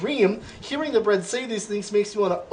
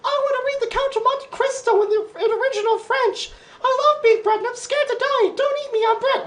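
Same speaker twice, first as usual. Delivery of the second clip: much higher, cartoonish bread voice, wailing; weeping bread. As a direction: squeak it out like a tearful little loaf. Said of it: "It keeps weeping and saying".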